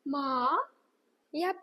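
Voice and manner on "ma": Ma? rising smoothly like a hopeful question; second tone rising. Shown as a demonstration. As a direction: lift ma upward as if asking. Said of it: "rise smoothly".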